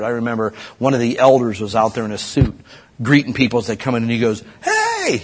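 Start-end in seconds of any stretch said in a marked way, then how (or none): 4.6-5.2 sharp high yell of a cranky church elder; imitated yell. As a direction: call out like a strict elder catching kids. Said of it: "he yelled at my".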